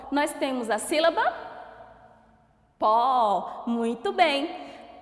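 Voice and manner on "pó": "pó" said alone with force, louder; isolated tonic "pó", loud and firm; stressed syllable. Shown as a demonstration. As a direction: give "pó" a strong, held beat. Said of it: "eu ponho mais força pra falar".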